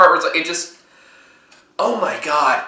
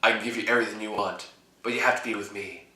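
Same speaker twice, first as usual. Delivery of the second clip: much softer, breathy-low seductive quote; mock dramatic line; 5 semitones lower, 13 dB quieter, faster than usual. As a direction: deliver it hushed like a cheesy lover. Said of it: "gives a really dramatic stare and goes".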